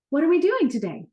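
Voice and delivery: high eager question; excited little boy asking his dad; excited child. A narrator's reading. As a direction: ask it bursting with excitement. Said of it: "asked Wade excitedly".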